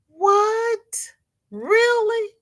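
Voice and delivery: very high-pitched, incredulous questions; astonished. Real, not acted. Streamer hearing of a viewer's Aussie GPS, astonished squeal. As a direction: squeal each word in disbelief.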